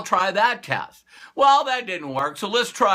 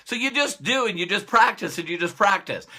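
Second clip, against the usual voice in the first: normal talking voice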